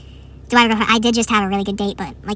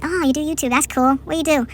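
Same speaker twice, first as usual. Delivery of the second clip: higher-pitched retold classmate reaction; imitating intrigued classmate; 6 semitones higher than usual. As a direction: play her curious and upbeat, pitched up. Imitating classmate peeking over.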